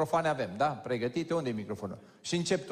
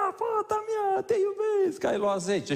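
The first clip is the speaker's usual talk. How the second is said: much higher, louder happy shout; imitated joyful yell; 4 dB louder than usual. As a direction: mimic a proud father yelling with joy. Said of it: "dacă îți place să urli, urlă". (strigăt imitat de bucurie, mult mai sus)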